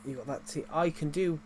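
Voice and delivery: ordinary delivery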